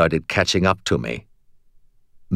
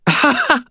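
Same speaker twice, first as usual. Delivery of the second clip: short, high burst of laughter; helpless laugh; 3 dB louder than usual. A narrator's reading. Battered knight can't help it, high helpless laugh. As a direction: laugh as if you cannot help it. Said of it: "I laughed again".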